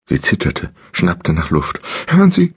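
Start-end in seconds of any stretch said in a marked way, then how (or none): 2.1-2.5 much higher, louder frightened stammer; stammering in fear. Acted. Captive woman pleading with the voice, high frightened stammer. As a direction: stumble out the words in panic. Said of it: "stammelte sie".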